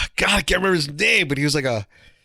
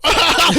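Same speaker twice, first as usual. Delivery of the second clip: choking laugh; 14 semitones higher, 6 dB louder than usual. Real, not acted.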